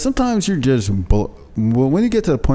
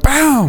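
high sharp Bam like a hit; cartoon punch sound effect; mock punch sound; 4 dB louder than usual. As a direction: shout the impact noise. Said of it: "Just wanna hit him in the throat".

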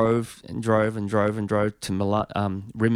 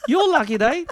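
put-on much higher and louder Italian accent; 6 dB louder than usual. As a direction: shout back in Italian accent. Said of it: "And they go".

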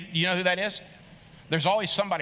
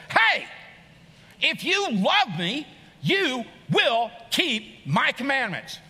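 loud, slow, higher commanding voice, punching each word; stern demand; 5 semitones higher, 3 dB louder, slower than usual. Shown as a demonstration. Preacher mimicking a harsh God demanding obedience, loud and stern. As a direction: bark it like a strict order, slowly. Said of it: "I believe the scripture read like this".

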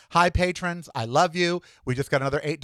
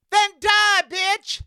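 angry shout; much slower than usual. Real, not acted. Angry retort to anti-vaxxer.

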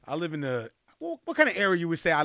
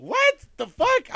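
high, loud, drawn-out shock; stunned disbelief; 7 dB louder, much slower than usual. Retold or imitated. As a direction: squeal it slowly in comic disbelief. Retold shock at hearing his name.

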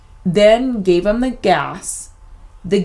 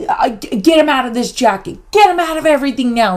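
high, loud, desperate stop; frantic yell to stop; 6 semitones higher, 3 dB louder, faster than usual. Retold or imitated. Mom yelling at the dentist to stop, frantic and loud. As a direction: cry out to halt everything at once. Mom demands son be freed.

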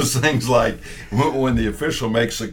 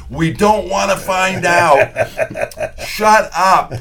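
higher, louder, drawn-out plea to stop; exasperated retort; 10 semitones higher, 5 dB louder, slower than usual. Retold or imitated. Colleague tells the heckler to shut up, loud exasperation. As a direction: cut off a friend who is pushing his luck.

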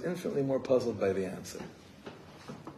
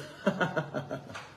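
a soft, airless laugh; breathy knowing chuckle; 5 semitones higher, 5 dB quieter than usual. Retold or imitated. Rebbe chuckling at the students' promise, soft and airless. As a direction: laugh quietly, all breath. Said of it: "The Rebbe says".

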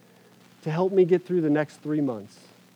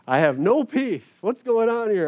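higher, louder panicked cry of alarm; freaking out; 8 semitones higher, 7 dB louder than usual. Retold or imitated. Pastor alone before surgery, panicked and loud. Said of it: "I'm, like, freaking out".

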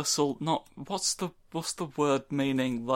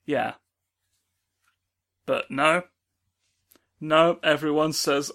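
ordinary delivery

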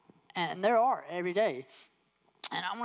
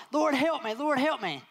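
yelling for help; 8 semitones higher than usual. Acted. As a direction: call out for help, high and urgent. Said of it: "people are yelling".

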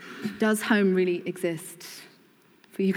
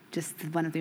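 ordinary talk

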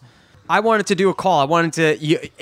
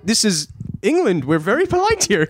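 attempted British accent; 3 semitones higher than usual. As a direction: lecture on politeness, mock prim. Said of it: "British accent".

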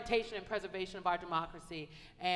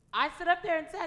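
rising retold speech; 10 semitones higher, 6 dB louder than usual.

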